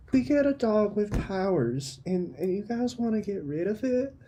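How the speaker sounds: put-on high, soft, upset child voice; small high-pitched voice of a child protesting; pleading kid Frankie. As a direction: plead softly like a hurt little kid. Plays Frankie, a fifth grader.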